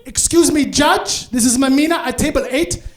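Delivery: high, loud challenge to the judge; calling out. Acted. Mom interrupts the trivia judge, calling out loudly. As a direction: call across the room to officials.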